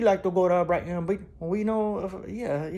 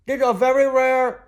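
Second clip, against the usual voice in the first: high, slow, louder appraiser voice; hammed-up Antique Roadshow appraiser; mock appraiser; 7 semitones higher, 7 dB louder, slower than usual. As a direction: ham up a TV antiques expert.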